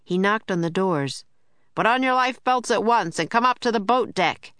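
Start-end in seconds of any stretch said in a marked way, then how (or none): 1.8-4.5 raised calling voice, a touch higher; calling out to passengers. A narrator's reading. Steward calls passengers to the boat deck, raised call. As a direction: call it down the hall to the cabins. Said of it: "he called".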